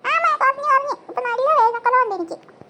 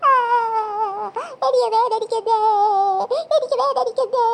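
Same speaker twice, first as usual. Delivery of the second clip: wailing in pain; 2 semitones lower than usual. Acted. Child in pain on the way to hospital, wailing. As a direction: stretch the words into a wail.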